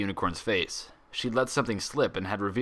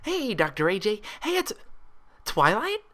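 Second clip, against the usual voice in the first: far higher, louder, squealing with joy; excited loud shout; 6 dB louder than usual. Acted. Pinkie rushing down the hallway at Twilight, joyful squealing shout. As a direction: shriek her name in delight. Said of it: "the loud shout".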